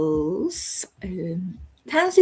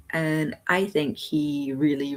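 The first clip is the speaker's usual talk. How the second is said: normal talking voice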